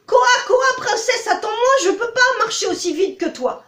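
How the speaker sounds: high, loud croaking plea; pleading toad. Acted. Toad calls to the fleeing princess, high and loud. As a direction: croak after her, begging her to wait. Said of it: "sort péniblement".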